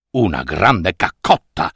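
low, puzzled donkey echo; donkey asks back in a low voice. Acted. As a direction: repeat it deep and baffled. Donkey repeats the request.

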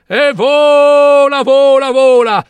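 high, loud, long-held vowels; soaring exclamation. A narrator's reading. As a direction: let it soar, stretched out and high. Narrator exults in the flight.

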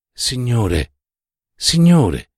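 put-on imploring older nun; pleading. A narrator's reading. Older nun beseeching the professor, pleading. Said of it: "supplicò l'altra suora più anziana".

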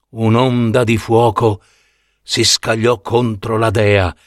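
usual speaking voice

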